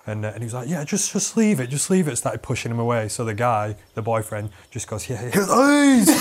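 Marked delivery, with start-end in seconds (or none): 0.7-2.2 higher, mock placating repetition; dismissive brush-off. Rob pushing the boyfriend away, higher and dismissive. 5.1-6.1 high, loud, drawn-out yell; mock outburst. Boyfriend snapping before he attacks, loud mock yell.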